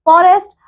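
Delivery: vocabulary headword read for the class; announcing a vocabulary word. Shown as a demonstration. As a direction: call out Forest like a heading. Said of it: "let's see the word meanings".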